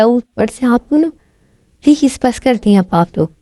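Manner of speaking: loud shouted family argument; shouting quarrel. Acted. Family members shout at each other over breakfast.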